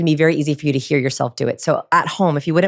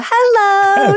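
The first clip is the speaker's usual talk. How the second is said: very high, louder sing-song Hello; squeaky dog-talk hello; 6 dB louder than usual. Retold or imitated. Coach imitates people greeting their dog, very high. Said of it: "I see people go".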